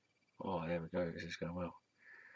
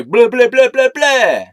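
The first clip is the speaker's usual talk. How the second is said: loud mock blah; 12 semitones higher, 10 dB louder, slower than usual. Skipping intro loudly.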